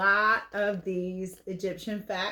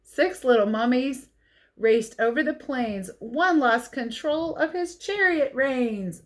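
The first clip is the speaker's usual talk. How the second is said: put-on lively read-aloud for kids; picture book reading; 4 semitones higher than usual. A narrator's reading.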